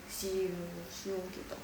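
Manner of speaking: soft, slow imitated gust of air; "siu" blown out slowly, like wind; breathy whoosh. Shown as a demonstration. Teacher imitating paper blowing in wind, quiet whoosh. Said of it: "dia langsung goyang".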